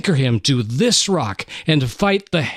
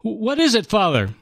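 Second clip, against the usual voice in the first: put-on young boy voice; 9 semitones higher than usual. Startled boy calling back.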